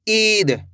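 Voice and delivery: "ईद" with a long, slow opening ee; drawn-out vowel. Shown as a demonstration. Teacher giving an example of big i, drawn-out vowel. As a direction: stretch the first sound of "ईद". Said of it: "इसको खींच के पढ़ेंगे".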